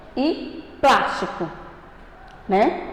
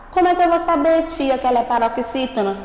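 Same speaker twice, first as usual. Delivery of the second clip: usual speaking voice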